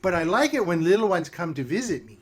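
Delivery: flirty witch voice. Acted.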